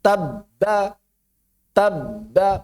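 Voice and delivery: "Tabba" stopped on the b, held silent, then ba; cut, unreleased b. Shown as a demonstration. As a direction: shut the lips on "Tabba" and wait before releasing. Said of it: "Suara terputus tapi tidak dilepas".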